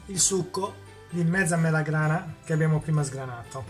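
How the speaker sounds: normal talking voice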